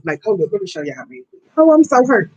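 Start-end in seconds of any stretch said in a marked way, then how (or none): 1.6-2.3 higher-pitched upset lament; worked-up, wounded complaint; hurt. Real, not acted. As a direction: wail lightly about how hurt you are. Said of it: "I'm hurt".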